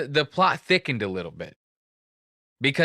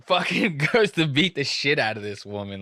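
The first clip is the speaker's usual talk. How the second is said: normal talking voice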